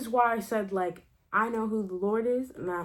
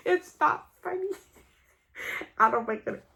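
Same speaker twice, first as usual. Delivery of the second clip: high, giggly protest breaking into laughter; helpless giggles cutting into the words; real laughing fit; 9 semitones higher than usual. Real, not acted. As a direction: try to talk through a real laugh. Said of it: "I don't know why I'm laughing".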